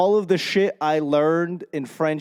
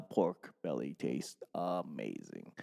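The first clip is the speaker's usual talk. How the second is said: very low, soft confession; hushed aside; 11 semitones lower, 15 dB quieter than usual. Real, not acted. Confessing love of meat during Lent, hushed. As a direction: drop right down, as if confiding.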